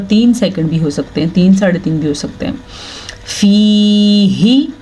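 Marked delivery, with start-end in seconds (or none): 3.3-4.7 फी ही with a long sustained ee; madd recitation demo. Shown as a demonstration. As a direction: stretch फी slowly, then a clear breathed ही. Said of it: "ये या मद्दा है".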